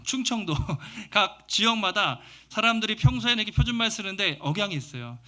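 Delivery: ordinary delivery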